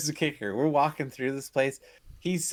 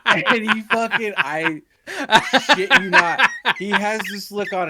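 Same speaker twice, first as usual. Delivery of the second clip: talking through real laughter, higher and louder; can barely get the words out for laughing; 8 semitones higher, 9 dB louder than usual. Real, not acted. Cracking up retelling the prank.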